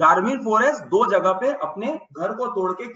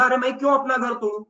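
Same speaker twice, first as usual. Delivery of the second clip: protesting; 5 semitones higher, faster than usual. Acted. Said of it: "बोला".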